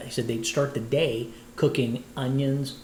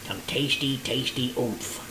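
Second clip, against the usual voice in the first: slow savoring slogan; slower than usual. Acted. Drawn-out slogan.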